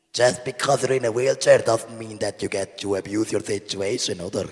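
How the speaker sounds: loud mock Swiss German accent; accent fédéral. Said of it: "he does the accent fédéral".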